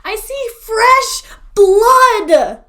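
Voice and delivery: high, loud, drawn-out proclamation; big theatrical high holler; dramatic cry. Acted. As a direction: stretch each word out like a horror announcement. Dramatic announcement of blood found.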